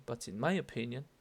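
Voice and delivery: MY made the peak of the phrase; stress on MY. Shown as a demonstration. Teacher repeating the opinion phrase, stress on MY. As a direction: lean on my, then let it rise. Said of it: "de nuevo, my".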